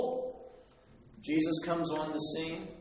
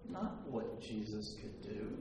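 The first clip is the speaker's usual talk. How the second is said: normal talking voice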